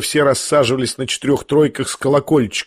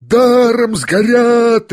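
high, long-drawn yell; crowd's roar; 9 semitones higher, 4 dB louder, much slower than usual. A narrator's reading. Crowd on all sides sees money burning, roaring. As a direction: howl it out slowly, vowels held. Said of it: "ревели со всех сторон".

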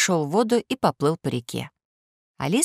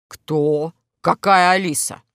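wolf voice; 3 dB louder, slower than usual. Acted. Wolf voice in story.

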